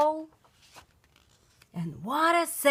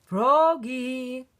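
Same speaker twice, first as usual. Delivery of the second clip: calling out; 3 semitones higher, 3 dB louder than usual. Acted. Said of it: "called his father".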